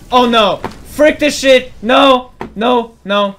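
high, loud frantic shouting; panicked yell. Real, not acted. Losing the fight.

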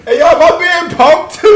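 high, loud laughing outburst; laughing hard. Real, not acted. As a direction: lose it completely laughing. Laughing at kid rapper.

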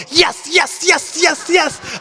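high, mock-triumphant yes yes yes; gleeful cheer. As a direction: cheer like a driver who just watched a bad driver get caught. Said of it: "I was just so happy".

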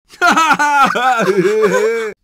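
very loud, high cackling outburst. Acted. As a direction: burst out loudly, high-pitched. Laughing at a prank.

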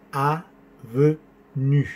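"avenue" very slow, a-ve-nue, rounded French vowels; "avenue" with rounded middle e and pursed final u; correct French pronunciation. Shown as a demonstration. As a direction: stretch "avenue" into three separate syllables. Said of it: "No es avenue".

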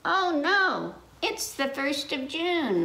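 worried farmer. Acted. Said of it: "Miss Mackenzie said".

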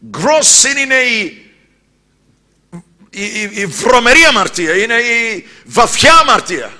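high, loud, pounding sermon; fiery preaching. Acted. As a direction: preach it hard to the congregation. Preacher at full force. (φλογερό κήρυγμα)

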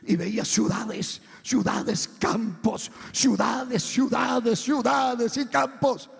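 building from strong to loud, stretched shout; intensidad creciente. Real, not acted. Preacher piles up vision, building. (cada vez más fuerte, casi gritando)

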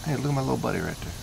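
ordinary delivery

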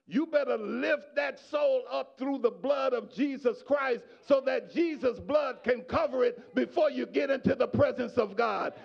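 normal talking voice

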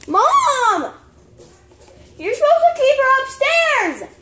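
high, loud yell for Mom; annoyed shout. Real, not acted. Child to mom about keeping the dog upstairs, annoyed shout. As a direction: holler a complaint to a parent in another room.

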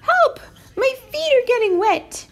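very high, loud panicky squeak; alarmed mouse squeak. A narrator's reading. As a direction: squeal for help like a frightened mouse. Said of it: "but Horatio squeaked".

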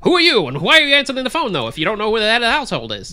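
shouted high, rapid newsreel voice; old newsreel narrator. Acted. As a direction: fire questions like a newsreel man. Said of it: "I lost my newsreel voice".

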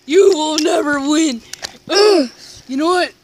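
high, loud shouted threat; pretend fighting taunt. Fighter beats foe with his own friend, shouted taunts.

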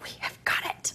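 hushed triumphant aside; whisper. Retold or imitated. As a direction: whisper it like a secret victory.

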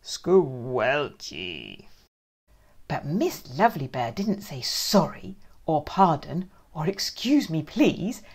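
ordinary delivery

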